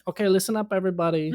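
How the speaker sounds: high, bossy announcing mom; imitating a mom addressing the whole table; mock announcement. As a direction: clap and call the group to order.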